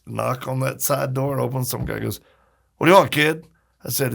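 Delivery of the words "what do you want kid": louder, higher, brusque doorman voice; gruff booth guy. Retold or imitated. Booth guy to the kid at the door, brusque and loud. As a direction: bark it at a pesky kid.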